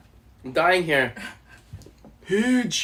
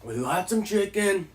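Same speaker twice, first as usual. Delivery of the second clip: Trump voice; 2 semitones higher than usual. Pretend. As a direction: do Donald Trump. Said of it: "Do Donald Trump".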